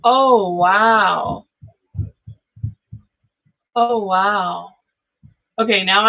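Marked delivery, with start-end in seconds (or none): none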